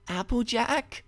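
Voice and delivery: lightly voiced heroine asking her friend; rising, hesitant name call; Twilight, uncertain call. A narrator's reading.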